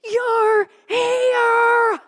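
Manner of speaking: high, very loud scream; full-voiced retold shriek. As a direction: scream it out in amazement. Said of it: "And I scream".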